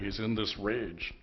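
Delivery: ordinary talk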